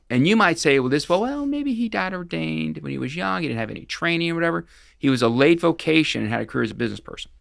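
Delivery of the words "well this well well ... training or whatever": higher-pitched voicing of someone's excuse; imagined excuse. Speaker imagines listeners excusing the priest, high-pitched excusing. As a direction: lift the voice, offering a hypothetical excuse.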